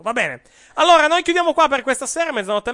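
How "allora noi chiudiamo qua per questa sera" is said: radio sign-off. Real, not acted. Radio host closing the show.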